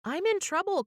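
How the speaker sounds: high-pitched fretting; mildly dismayed, pitched well up; worried little ghost. A narrator's reading. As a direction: fret in a small, high ghost voice. Little ghost frets to his friends.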